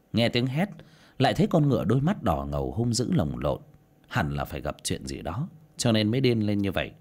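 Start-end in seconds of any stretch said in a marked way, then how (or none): none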